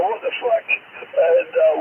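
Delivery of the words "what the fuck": high, breathy mock disbelief; retold disbelief. Mates staring at a tiny lobster, squeaky disbelief. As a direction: squeak it out in shock. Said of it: "And I go".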